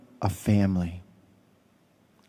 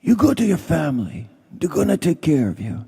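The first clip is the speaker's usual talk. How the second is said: higher, Sicilian-American mobster voice; mafia don impression; 6 semitones higher than usual. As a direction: play the friendly godfather offering favors. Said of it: "I'll put it in the voice of my friend".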